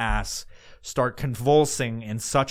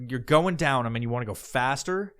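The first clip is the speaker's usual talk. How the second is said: usual speaking voice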